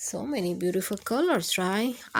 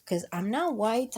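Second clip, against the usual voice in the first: usual speaking voice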